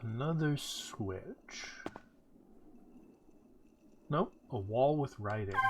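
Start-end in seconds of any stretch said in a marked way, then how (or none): none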